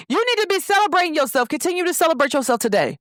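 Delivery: high, quick urging; insistent friend. Retold or imitated. Friend urging the new graduate to celebrate, high and insistent. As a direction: imitate a friend's high, fast pep talk.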